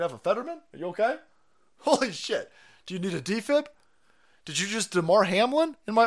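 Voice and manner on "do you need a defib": ordinary talk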